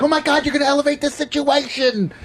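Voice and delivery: very high, panicky mock female voice; imitated nervous woman. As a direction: imitate a nervous passenger squealing in alarm. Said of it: "she's, like, all nervous".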